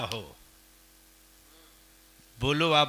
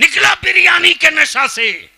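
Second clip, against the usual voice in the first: high, full-force shout; thundering rebuke; 13 semitones higher, 13 dB louder than usual. Real, not acted. Preacher scolding his community to wake up, thundering. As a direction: roar it out at the crowd.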